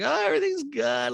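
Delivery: high, put-on cheeriness; Kyle. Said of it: "Kyle's like".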